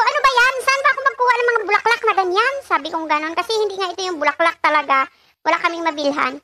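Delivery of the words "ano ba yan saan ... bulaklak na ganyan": exasperated quote of herself. Retold or imitated.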